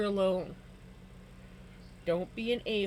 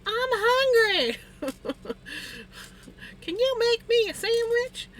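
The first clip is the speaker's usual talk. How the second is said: high, slow, louder whiny mom; imitating mom; 14 semitones higher, 6 dB louder than usual. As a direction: beg for a sandwich in a high whine. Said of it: "My mom's like".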